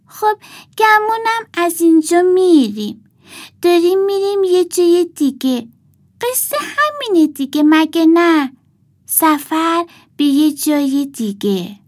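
put-on high-pitched doll girl, matter-of-fact; little girl doll. A narrator's reading. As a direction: speak up high like a small girl.

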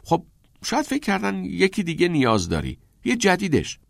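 high-pitched, helpful offer of an explanation; lifted, hopeful suggesting tone; tentative suggestion. A narrator's reading. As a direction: suggest it lightly to a friend. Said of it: "نیل محض پیشنهاد گفت".

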